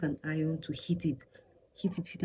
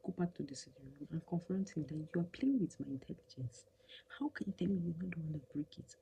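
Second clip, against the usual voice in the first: soft, fast inner urging; hushed voice in her head; 9 dB quieter than usual. Retold or imitated. Wife voicing the angry voice in her head, softly. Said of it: "One voice was just like".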